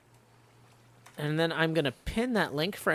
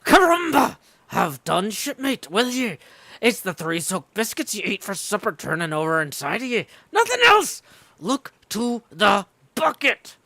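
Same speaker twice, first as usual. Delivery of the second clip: scoffing shout; 2 semitones higher, 6 dB louder than usual. Acted. Sailor shouting down Archie's cough story, scornful bellow. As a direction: scoff at him and bark the order. Sailor mocking shipmate loudly.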